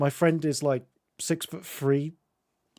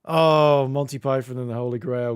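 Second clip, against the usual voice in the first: normal talking voice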